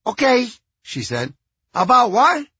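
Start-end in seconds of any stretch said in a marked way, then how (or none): 0.1-0.5 put-on high Cuban-accented woman; thick Cuban accent, higher. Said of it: "A woman with a thick Cuban accent". 1.7-2.5 put-on high Cuban-accented woman; thick Cuban accent, higher. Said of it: "A woman with a thick Cuban accent".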